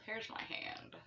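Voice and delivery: playful whispered aside; mock whisper. Joking with viewers about her hand, playful whisper. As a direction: whisper it as a silly secret.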